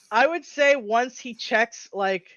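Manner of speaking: ordinary talk, nothing marked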